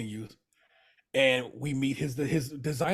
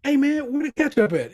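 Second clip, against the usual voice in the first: lightly voiced casual call, a bit higher and louder; imitating Carmi; 10 semitones higher, 4 dB louder than usual. Mock Carmi asking for ketchup, breezy. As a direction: call out across the party. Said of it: "Carmi's like".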